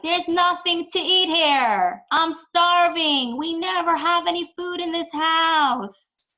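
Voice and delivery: put-on high, loud, slow complaining kid at the fridge; whiny demanding kid. Child complaining to parent at the fridge, high whiny demand. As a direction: whine it out like a starving child. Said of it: "they open the fridge and they're like".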